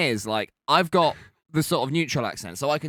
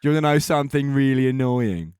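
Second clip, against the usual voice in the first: broad Birmingham voice; conversational Brummie impression; Brummie accent; 4 dB louder than usual. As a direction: ask it in thick Brummie. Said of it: "That's a good Brummie accent".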